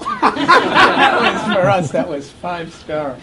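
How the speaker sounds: laughing through the words, slowing down. Real, not acted. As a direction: savor the memory while cracking up. Laughing over the bunk bed story.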